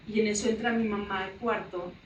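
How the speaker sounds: usual speaking voice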